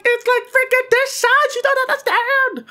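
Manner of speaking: very high, loud falsetto panic; mock frantic shriek. Son showing mom the spider's size, hammed-up frantic squeal. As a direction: squeal in slow, exaggerated desperation. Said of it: "I'm like".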